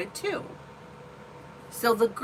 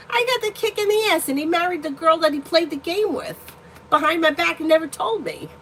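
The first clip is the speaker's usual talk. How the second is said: high, loud, indignant rant; real bitter anger at the ex, loud and high; bitter outburst; 8 semitones higher, 5 dB louder than usual. Real, not acted. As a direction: let the hurt burst out loud and high. Angry rant about the ex.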